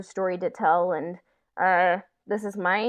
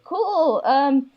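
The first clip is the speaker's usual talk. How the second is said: mock cheeky ask; 9 semitones higher, 5 dB louder than usual. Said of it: "it's like".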